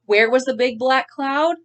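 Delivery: lively picture-book reading; story narration. Acted. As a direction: read the ending to kids. Teacher finishes reading aloud.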